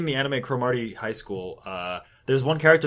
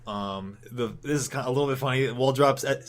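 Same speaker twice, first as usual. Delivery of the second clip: ordinary delivery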